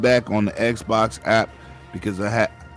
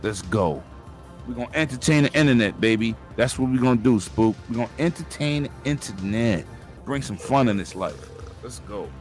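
low, soft hype pitch; quietly recounting his own rallying post; understated, low-voiced callout; retold pitch; 6 semitones lower, 6 dB quieter than usual. Retells his own earlier post.

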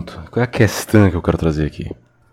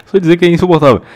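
higher, louder exasperated outburst; 9 semitones higher, 6 dB louder, much faster than usual. Real, not acted. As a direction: blurt it out fed up.

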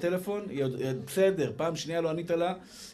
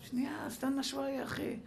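high, hushed plea to wait; mock exasperated driver; 9 semitones higher, 8 dB quieter than usual. Driver to honking man behind, high hushed protest. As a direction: squeeze it out softly in a high voice.

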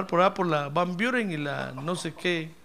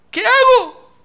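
sudden high loud cry for help; desperate plea; 11 dB louder, slower than usual. Retold or imitated. Smoking brother asking the pastor what to do, high and desperate. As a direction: beg for an answer at the top of the voice. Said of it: "Una vez me dijo".

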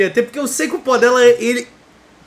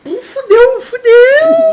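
mock wail; 13 semitones higher, 7 dB louder, much slower than usual. Mock despair at a promise.

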